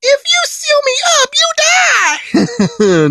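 high, loud shrieking villain voice; Boo threatening. Retold or imitated. Boo threatening Babidi, shrieking high and loud. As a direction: screech the threat like a cornered monster. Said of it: "Boo is like".

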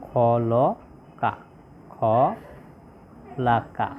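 খলাকা stretched out very slowly, syllable by syllable, খ-লা-কা; slow syllable demo. Shown as a demonstration. Teacher spelling out the word for students, very slow. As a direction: draw out খলাকা slowly so each syllable of খ লা কা is clear. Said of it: "তাহলে কি হলো?".

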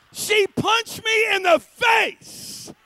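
high, very loud, drawn-out outburst; hammed-up high shout of disbelief; outraged shouting. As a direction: holler it slowly in comic outrage. Comic outrage at retold punch.

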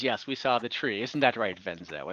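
a hint of a soft-spoken, low NPC; Gillen's voice. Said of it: "Gillen says".

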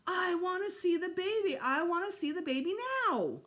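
high, loud wailing demand; howling tantrum. A narrator's reading. Fudge banging his spoon at dinner, howling. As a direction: howl it like a spoiled child.

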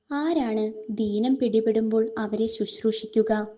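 ordinary delivery

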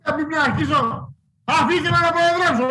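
exasperated, high-pitched protest; real temper, voice jumping high; angry outburst. Real, not acted. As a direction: demand order at the end of your tether. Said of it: "Ηρέμησε".

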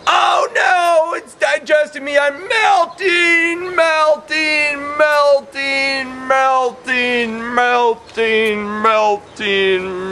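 high hammed-up wail of melting garbage, slowly fading; mock melting cry. Teacher playing garbage being digested, hammed-up high wail. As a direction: wail like the Wicked Witch melting away.

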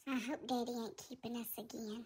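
low, soft worried pet voice; timid cat Tigger. Acted.

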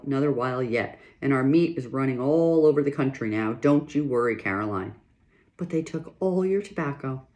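lively read-aloud voice with characters; story narration. A narrator's reading. Reader performing a pioneer family chapter, storytelling voice. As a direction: read the chapter to listeners with feeling.

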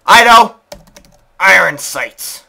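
loud burst of inspiration, slowing on the name; excited announcing. Real, not acted. Player finds a name for the baby, excited announcing. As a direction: blurt it, then proclaim the name slowly.

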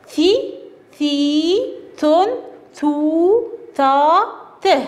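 each syllable sounded out slowly and clearly, with pauses between; teacher modelling syllables; drilling letter sounds. Shown as a demonstration. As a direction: chant the letter-vowel syllables one at a time for children. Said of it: "الآن كلمات تتضمن هذه الحروف".

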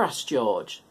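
usual speaking voice

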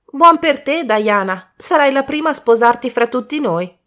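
high, loud, lively cheer; excited congratulation. Acted. Friend tells Diana she will marry first, excited. Children cheering the ring's finder.

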